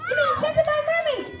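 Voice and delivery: high, urgent wake-up shout. A narrator's reading. Ladies shouting at the sleeping sailors to flee. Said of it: "clapping ... and shouting".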